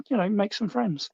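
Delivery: usual speaking voice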